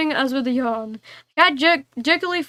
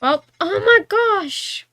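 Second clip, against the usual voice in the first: high, slow drawn-out dismay; shocked gasp; 6 semitones higher, much slower than usual. Real, not acted. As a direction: stretch out the shock. Lamp crashes in story.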